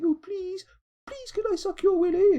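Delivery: high squeaky pleading voice; begging whine. Pretend. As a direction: beg in a shrill falsetto. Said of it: "begging on their bended knees saying".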